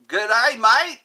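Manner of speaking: loud, high Australian "Good day, mate"; hammed-up Aussie accent, called out brightly; Australian greeting. Greeting an Aussie friend in chat with a mock Australian accent. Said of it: "My great-grandfather is Australian".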